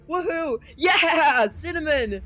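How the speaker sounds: high, loud whoop; excited cheer. Real, not acted. Player cheering a vote for Cinnamon, excited shout. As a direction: shout it out in celebration.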